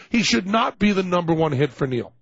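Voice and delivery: ordinary talk, nothing marked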